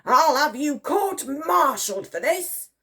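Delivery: high, drawn-out angry threat; raging. A narrator's reading. Admiral threatening the private, raging. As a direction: threaten slowly in a rage. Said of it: "raged the Admiral".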